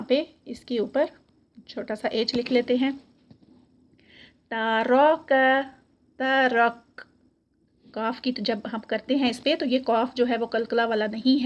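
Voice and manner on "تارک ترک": تارک and ترک with a heavy ra and a light breathy kaf; Quranic recitation. Shown as a demonstration. Teacher reciting تارک and ترک. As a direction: recite the words in tajweed style. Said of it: "اور کاف پھر light".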